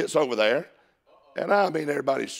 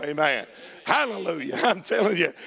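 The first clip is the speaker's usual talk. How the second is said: ordinary delivery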